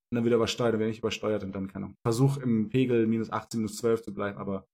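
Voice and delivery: ordinary talk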